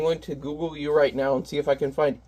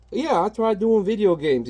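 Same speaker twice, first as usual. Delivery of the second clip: higher, sneering comic villain; lightly voiced whiny bad guy; 6 semitones higher than usual. The villain complains about his failed channel, lightly voiced. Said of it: "So he's like".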